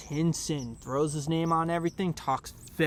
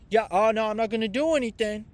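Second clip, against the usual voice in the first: put-on guy voice; 7 semitones higher, 8 dB louder, faster than usual. Mocking the Missouri guy who kicked him out, loud and higher. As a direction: imitate the guy, fast and hammed up. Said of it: "sorry, I'm talking like that".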